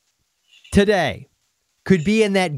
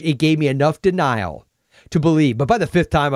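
ordinary talk

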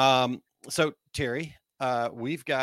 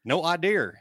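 Missouri drawl; 7 semitones higher, 4 dB louder than usual. Pretend. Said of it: "I just kinda went with my accent".

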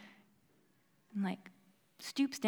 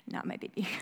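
laughing whisper; 10 dB quieter than usual. Real, not acted. Baby sound interrupts recording.

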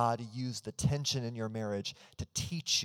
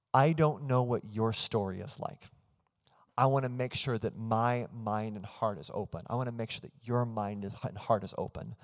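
ordinary talk, nothing marked

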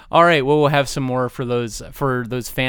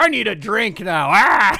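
mock tauntaun; 7 semitones higher, 4 dB louder than usual. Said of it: "Then it's like".

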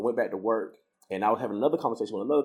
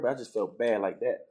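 usual speaking voice

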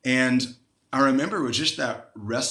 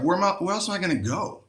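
desperate retold plea; 6 semitones higher, faster than usual. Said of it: "I was like".

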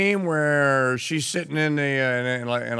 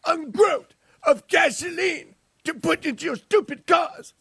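high, shouted mock Greta Thunberg; Greta rant; 11 semitones higher than usual. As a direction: screech it like an outraged teen activist. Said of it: "Greta impression, mocking".

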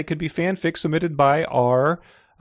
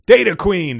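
bright rising greeting, a little louder; gushing over an old friend; gushed; 6 semitones higher, 4 dB louder than usual. A narrator's reading. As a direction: greet her warmly with delight. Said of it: "the huge man gushed".

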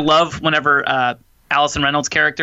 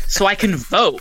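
lightly mocking nerd comeback, pitched up; a hint of a nerd, higher pitched; nerd response; 6 semitones higher, slower than usual. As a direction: toss it out as painfully obvious. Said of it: "it was the perfect nerd response".

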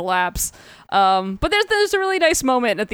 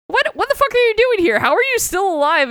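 high, fast mock disbelief; incredulous outburst; 12 semitones higher, faster than usual. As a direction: demand answers, hammed-up and rapid. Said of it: "and he's just like".

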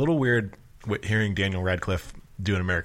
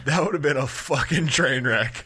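amused disbelief; 6 semitones higher, 3 dB louder, slower than usual. Real, not acted. Laughing, piling on the casting idea.

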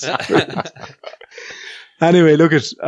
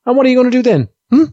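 imitating Audrey; 11 semitones higher, 4 dB louder, much faster than usual. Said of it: "she'll do something like".

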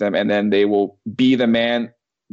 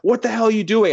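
retold coach's yell; 10 semitones higher than usual. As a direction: imitate a coach yelling in protest. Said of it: "tried to yell at us".

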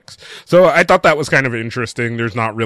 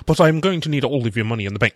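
put-on British accent. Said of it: "Is that your British accent?".

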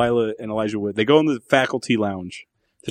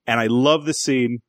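ordinary talk

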